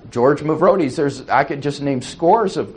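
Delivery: ordinary delivery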